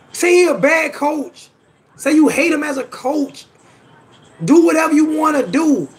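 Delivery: real exasperated insistence; emphatic rebuke. Real, not acted. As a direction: lay it out firmly for a rude viewer. Upset rebuke of insulting commenter.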